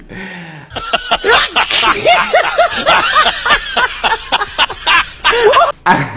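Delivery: shrieking burst of laughter; real howl of laughter; loud high laugh. Real, not acted. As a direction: crack up loudly at yourself. Laughs at own story.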